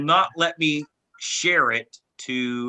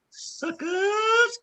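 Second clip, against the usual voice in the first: very high, drawn-out mocking squeal; singsong high jeer; high-pitched taunt; 3 dB quieter than usual. Real, not acted. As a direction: tease the losers in falsetto. Taunts the others he beat.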